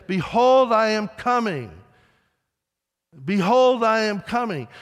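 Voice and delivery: loud, high pulpit call, said twice; repeated proclamation. Retold or imitated. Preacher repeating his line after forgetting, loud. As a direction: call it out again to fill the silence. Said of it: "So he thought, "I'll just say it".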